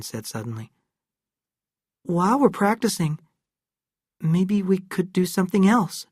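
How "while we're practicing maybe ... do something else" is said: put-on higher hesitant boy's voice; a shy boy floating an idea; young boy Erin. A narrator's reading. Erin voiced in read story.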